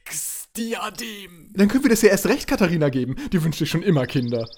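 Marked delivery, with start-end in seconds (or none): 0.1-1.5 hushed reveal. Acted. Grand reveal of object.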